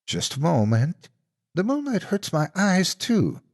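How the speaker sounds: a monster playing a kindly aunt; lightly voiced fake auntie.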